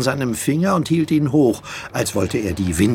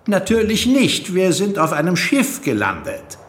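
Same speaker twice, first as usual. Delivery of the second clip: put-on girl's voice; 8 semitones higher than usual. A narrator's reading. Alex answers the Doctor.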